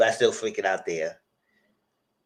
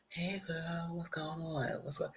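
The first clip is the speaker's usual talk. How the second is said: much softer, casual greeting; soft phone greeting; 6 semitones higher, 16 dB quieter, slower than usual. Acted. Kimmy answering Rain's call, much softer. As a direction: murmur it like picking up the phone.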